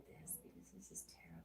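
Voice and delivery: whispered aside, unvoiced. Real, not acted. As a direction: mutter it off-mic.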